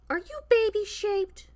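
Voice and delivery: high delighted gasp and coo. Real, not acted. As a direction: gush at the cat. Cooing at cat.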